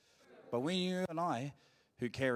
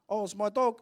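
yelled across road; 7 semitones higher, 7 dB louder than usual. Retold or imitated. Cousin yelling across the road.

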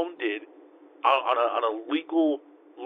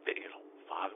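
bitter whispered mutter; hurt under-the-breath whisper; 5 semitones lower, 14 dB quieter than usual. Real, not acted. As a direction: whisper it to yourself, stung.

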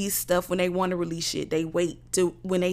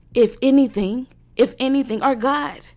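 louder and higher; outburst; 5 semitones higher, 8 dB louder than usual. Real, not acted. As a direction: erupt. Sudden outburst.

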